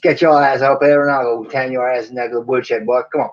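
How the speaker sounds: hammed-up Southern cowboy scolding; attempted Southern drawl. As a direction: play a Southern old-west type with a drawl. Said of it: "my attempt at a Southern draw".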